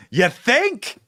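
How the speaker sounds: high, exaggerated mock disbelief; sarcastic. Real, not acted. Host reacting to a commentator's late realisation, sarcastic. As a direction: pitch it way up, as if stating the obvious.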